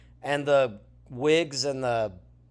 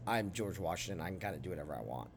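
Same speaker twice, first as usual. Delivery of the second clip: low, soft throwaway joke, quick at the end; joking aside; 9 semitones lower, 16 dB quieter, faster than usual. Pretend. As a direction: mutter it like a private joke, speeding up. Joking aside about his own role.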